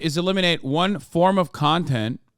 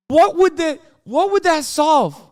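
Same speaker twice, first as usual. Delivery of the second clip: exasperated challenge; 10 semitones higher, 6 dB louder than usual. Real, not acted. Ranting at critics.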